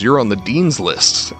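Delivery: normal talking voice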